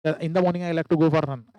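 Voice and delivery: no break after morning; wrong way, the way many students read it; run together. Shown as a demonstration. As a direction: rush through without stopping. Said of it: "ऐसा बहुत सारे student पढ़ रहे हैं".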